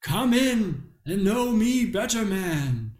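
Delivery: slow, high booming ghost voice; jolly giant ghost. Retold or imitated. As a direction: play the big jolly ghost. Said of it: "big ghost of Christmas present".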